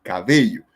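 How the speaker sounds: cabello stressed correctly on -be-; stress on middle syllable. Shown as a demonstration. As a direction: put the weight on the middle of cabello. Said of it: "decimos cabello".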